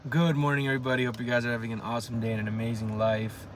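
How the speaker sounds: loud, upbeat camera greeting; cheerful YouTube intro; vlogger greeting. Acted. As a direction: greet the viewers brightly.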